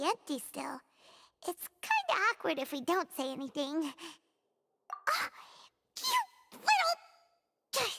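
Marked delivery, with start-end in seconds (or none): 5.1-7.9 much higher, slow, drawn-out indignant whine; mock outrage at a friend; exasperated. Paimon grumbling at the Traveler, exasperated.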